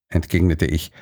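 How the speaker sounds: ordinary delivery, nothing marked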